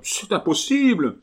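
aigu et plus fort, refus paniqué; terreur incrédule. A narrator's reading. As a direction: rejeter l'idée, épouvanté. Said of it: "la plus profonde terreur". (le malade refuse de croire le moine, terrifié)

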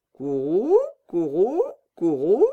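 a cooing bird call, rising; pigeon coo. Acted.

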